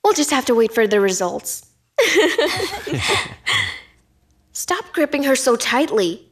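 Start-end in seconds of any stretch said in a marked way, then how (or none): 2.0-3.8 high, rapid run of laughter; gloating laugh. Acted. Plotter waiting for the results, laughing gleefully. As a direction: laugh with glee at your scheme.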